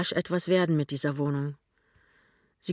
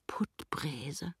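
very soft whisper; hushed, private musing; thinking to herself; 11 dB quieter than usual. A narrator's reading. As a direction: murmur the name in a whisper. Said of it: "denkt sie".

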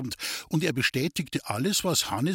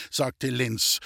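ordinary delivery

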